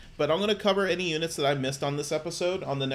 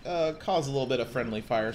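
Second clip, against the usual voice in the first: normal talking voice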